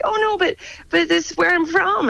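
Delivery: hammed-up helpless objection in a high voice; plaintive protest. Student protesting to the speech teacher, high plaintive protest.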